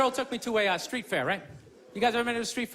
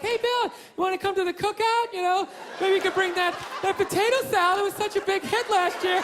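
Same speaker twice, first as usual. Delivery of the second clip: friendly neighbor; 5 semitones higher than usual. Retold or imitated.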